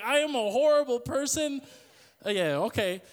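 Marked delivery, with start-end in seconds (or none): 0.0-1.6 mock self-reproach. Retelling guilt after making someone cry.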